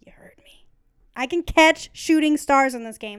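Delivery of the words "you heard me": teasing whisper. Real, not acted. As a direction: whisper it with mock menace.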